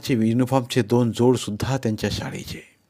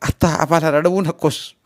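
raised voice welling with feeling; choked with emotion; 6 semitones higher, 4 dB louder than usual. A narrator's reading. Sister to her brother with tearful eyes, choked with emotion. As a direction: hold back tears while pleading. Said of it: "गदगद आवाजात ती म्हणाली".

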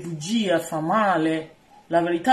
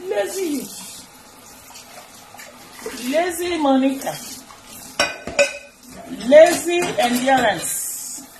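mocking name-calling; 5 semitones higher, 3 dB louder than usual. Streamer teasing lazy people, high mocking call.

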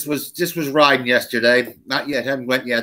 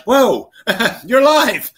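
high, slow, laughing whoa; laughing surprise; 10 semitones higher, 5 dB louder, much slower than usual. Retold or imitated. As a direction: laugh it out like seeing a ghost. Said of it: "he's standing there like".

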